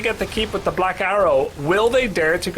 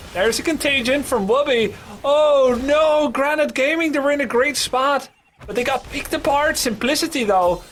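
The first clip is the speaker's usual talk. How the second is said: higher, lively game call; esports caster; 10 semitones higher than usual. Acted. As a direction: keep the call rolling. Live play-by-play.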